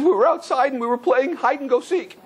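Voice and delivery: put-on high childlike retelling, mildly tearful; crying boy. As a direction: voice a distressed child recounting what happened. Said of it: "who was crying, was weeping very strong".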